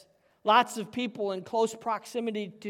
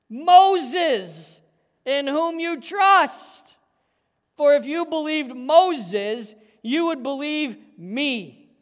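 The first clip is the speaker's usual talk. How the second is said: preached scripture; 2 semitones higher, 8 dB louder, slower than usual. Acted. Pastor preaching Jesus's rebuke to the leaders, full force.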